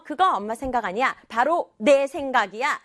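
firm correction landing on 내; stress on 내. Acted. Girl corrects whose idea it is, stressing 내. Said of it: "라고 강조를 했기 때문에".

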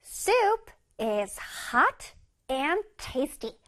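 very slow, each word drawn out; slow model reading. Shown as a demonstration. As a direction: read slowly for the kids to copy. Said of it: "One more time".

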